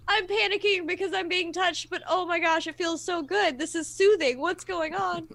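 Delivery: high, mock-panicked gush of relief; hammed-up overwhelmed friend, pitched high; imitating panicked friend. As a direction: playfully act out panic melting into pleasure. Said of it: "It was just hilarious to watch".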